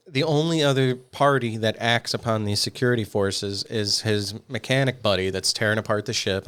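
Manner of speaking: ordinary talk, nothing marked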